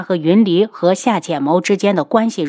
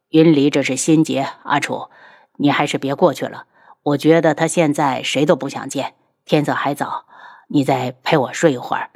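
soothing husband; 4 semitones lower than usual. Acted. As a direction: gently talk her down. Voices the husband coaxing his wife. (低声安抚妻子)

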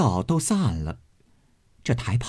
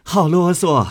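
mock impatience; 7 semitones higher, 4 dB louder than usual. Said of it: "将桌子一拍".

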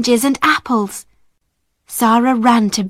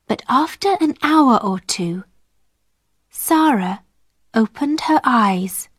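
plain reading voice

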